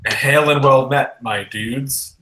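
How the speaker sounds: slow, hearty-volume hail to strangers; in-character shout. Acted. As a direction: call it out slowly, half shouting. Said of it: "where I shout".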